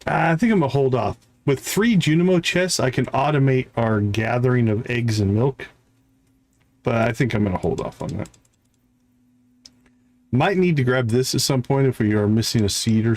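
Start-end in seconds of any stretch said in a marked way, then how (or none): none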